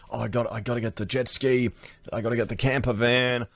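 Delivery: light imitation of a toy-loving builder; mock tradie builder. Vlogger mocking the builder who owns the house, lightly imitating. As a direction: mildly send up the bloke who wants all the gear. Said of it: "some builder that's built it himself".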